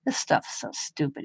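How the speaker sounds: husband grumbling about her ghost videos, whispered; whispered scoff. Retold or imitated. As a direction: breathe it out with scorn. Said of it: "he goes".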